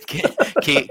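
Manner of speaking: normal talking voice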